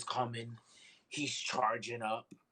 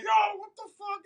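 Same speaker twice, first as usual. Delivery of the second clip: very high, loud mock yell; screaming in pain; 8 dB louder than usual. Child woken by burning pain, high loud mock scream. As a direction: yell it in shrieking, pained outrage. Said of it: "screaming in pain".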